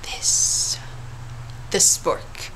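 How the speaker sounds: dramatic whisper. Acted. Dramatic reveal of the spork.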